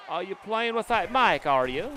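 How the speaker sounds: high, drawn-out mock English wife; bad English accent. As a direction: imitate a buddy's wife with a badly faked English accent. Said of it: "the England accent".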